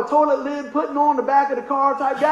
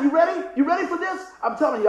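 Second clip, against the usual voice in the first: normal talking voice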